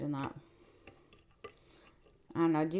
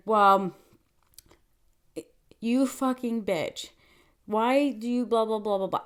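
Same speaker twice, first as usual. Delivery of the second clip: principal reciting an insult, slow and emphatic; mock read-aloud, drawn out, raised and louder; reading word for word; 3 semitones higher, 6 dB louder than usual. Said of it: "reading word for word".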